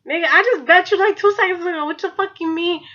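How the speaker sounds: high, incredulous retort; indignant protest. Retold or imitated. Girl answering a guy who called her his wife, indignant protest.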